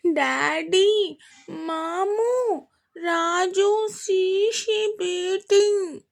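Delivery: put-on high, slow toddler telling on mum; complaining child. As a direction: whine it to daddy like a tattling little girl. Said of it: "అంది complaint ఇస్తున్నట్టు".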